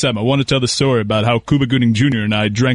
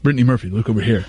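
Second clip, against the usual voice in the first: put-on fast snooty teen boy; Elton from Clueless impression; 2 semitones lower, faster than usual. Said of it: "fake Elton from Clueless".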